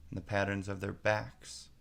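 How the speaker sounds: ordinary delivery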